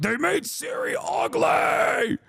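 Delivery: high, slow whiny mock shout; mocking angry gamers. Mocking gamers who rage that Siri was made ugly, in a whiny high shout. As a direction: sneer at the outraged fans in a shrill-pitched wail. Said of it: "these guys are like".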